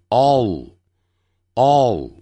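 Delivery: all drawn out, tongue tip lifted at the end; slow all with a long, falling final L; final L demo. Shown as a demonstration. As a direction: stretch all into a held L. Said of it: "尖翘起来".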